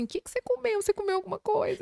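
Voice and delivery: high, light voice quoting your own anxious questions; retold concerned questioning. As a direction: re-enact checking on someone who just fainted, pitched high. Retold urgent questioning of fainted client.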